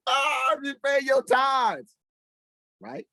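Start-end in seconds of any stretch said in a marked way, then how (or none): 0.1-2.0 high laughing shout at the preacher; mocking laugh. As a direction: laugh it off scornfully.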